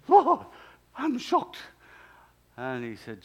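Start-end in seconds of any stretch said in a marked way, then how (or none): 0.1-1.6 put-on high, loud, shocked South American accent. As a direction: imitate a startled South American pastor, pitched way up. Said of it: "South American accent".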